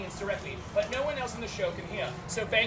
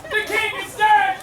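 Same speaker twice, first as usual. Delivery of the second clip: slow, loud, higher-pitched outcry; proclaiming shout; 7 semitones higher, 9 dB louder, slower than usual. Acted. Noble announcing the king's death for all to echo, proclaiming shout. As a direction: shout it out, stretching the words. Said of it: "the noble shouts".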